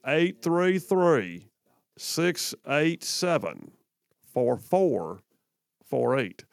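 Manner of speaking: very slow, drawn-out digits with long pauses; nice slow accent. Shown as a demonstration. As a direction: chew each number slowly like molasses. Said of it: "told to slow that down".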